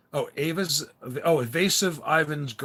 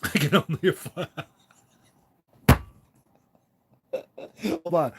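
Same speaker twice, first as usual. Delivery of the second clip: real laughter breaking into the words; cracking up mid-sentence; laughing; 4 semitones higher, 4 dB louder than usual. Real, not acted. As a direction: try to read it out through laughter. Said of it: "understand why I'm laughing".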